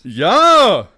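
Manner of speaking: loud insistent yes; defiant shout. Acted. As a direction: yell it back at him. Insisting loudly.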